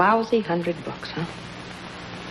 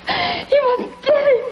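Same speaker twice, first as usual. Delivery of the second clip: high, slow words broken by laughter; cackling, half-crying glee; hysterical laughing; slower than usual. Acted. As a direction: laugh wildly through the line, near tears. Bitter gloating over his new face.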